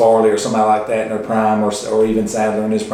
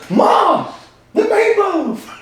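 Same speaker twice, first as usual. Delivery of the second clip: famous meatloaf yell; much slower than usual. Retold or imitated. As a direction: yell upstairs like a spoiled grown son. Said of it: "world famous".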